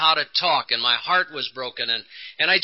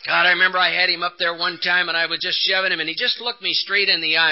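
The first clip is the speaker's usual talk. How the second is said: normal talking voice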